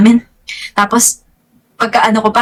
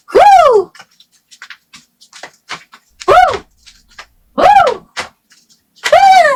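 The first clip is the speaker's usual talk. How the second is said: excited whoops; much slower than usual. Acted. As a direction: whoop like you just saw a huge room. Showing the huge hotel room.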